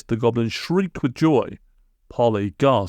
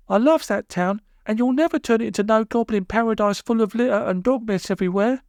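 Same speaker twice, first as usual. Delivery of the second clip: Polly's voice; 12 semitones higher than usual. A narrator's reading. Polly defying Mister Gum.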